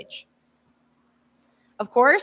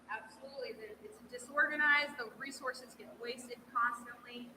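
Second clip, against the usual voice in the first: distant, echoing hall sound; speaking off-mic in an echoey room; 17 dB quieter than usual. Real, not acted. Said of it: "it's so echoey in here".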